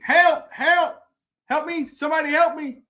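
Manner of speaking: high-pitched retold cry for help; mock scream for help. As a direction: call up out of the hole, half-screaming. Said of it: "And he starts screaming".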